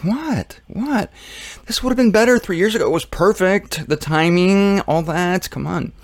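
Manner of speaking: high, excited and incredulous; excited Jesus. Pretend. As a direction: shoot it up high in disbelief. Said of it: "Jesus is all excited".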